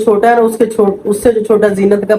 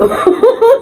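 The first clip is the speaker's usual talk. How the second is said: high laugh; 12 semitones higher than usual. Real, not acted. Laughing high as she retells giving the money.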